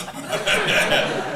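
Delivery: high, loud airy laughter; breathy real laugh. Real, not acted. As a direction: keep laughing helplessly. Still laughing at the story.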